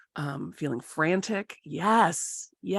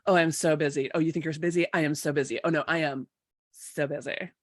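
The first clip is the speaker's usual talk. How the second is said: ordinary talk, nothing marked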